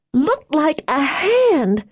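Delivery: put-on slow, high, frightened girl voice; scared, drawn-out words. A narrator's reading.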